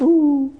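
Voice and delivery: high, loud indignant ooh; a huff of wounded entitlement. Retold or imitated. Tired husband sounding his wounded entitlement.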